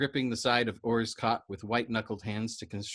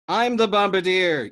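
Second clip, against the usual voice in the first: loud high shout back; crying out; 9 semitones higher, 10 dB louder than usual. Acted. Yossarian shouts back to Dobbs on the intercom, loud. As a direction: yell it back over the noise. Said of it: "Yossarian cried back at him".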